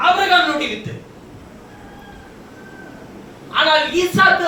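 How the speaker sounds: high, insistent preacher's proclamation; forceful preaching. Acted. Preacher contrasting Isaac with the weeping crowd, forceful preaching. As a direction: proclaim it to the whole congregation.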